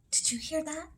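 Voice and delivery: plain reading voice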